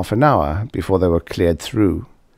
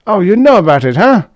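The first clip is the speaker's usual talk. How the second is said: a somewhat louder, higher tipsy loudmouth; Karsh, tipsy braggart; 12 semitones higher, 8 dB louder, faster than usual. A narrator's reading. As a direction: play the boozy blowhard at the bar. Karsh voiced in story.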